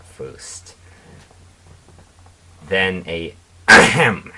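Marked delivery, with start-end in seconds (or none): 3.7-4.3 loud, acted throat clear; throat-clearing ahem. A narrator's reading. Pony cutting in to be heard, loud ahem. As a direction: clear your throat loudly and pointedly. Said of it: "from a Pegasus pony".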